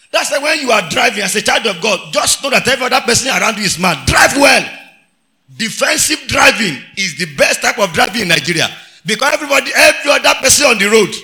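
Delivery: loud, forceful sermon exhortation; full-force preaching. Real, not acted. Preacher warning the congregation about careless drivers, shouted. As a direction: drive the warning home at high volume.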